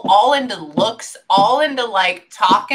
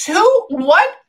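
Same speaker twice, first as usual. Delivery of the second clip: sharp high who, what; surprised; 9 semitones higher, 5 dB louder than usual. Real, not acted. Medium startled by a spirit's remark, surprised. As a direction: caught off guard, pitch jumps. Sudden confused outburst.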